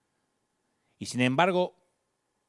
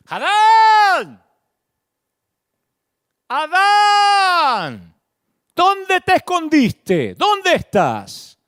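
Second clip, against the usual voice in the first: much higher, much louder long call; God calling out for hiding Adam, loud and slow; 13 semitones higher, 11 dB louder, much slower than usual. Pretend. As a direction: call across the garden, stretching each word slowly. Preacher voicing God calling Adam.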